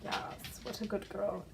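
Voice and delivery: gently adore the cat; soft pet praise. Real, not acted. Owner softly praising her cat, slow and soft.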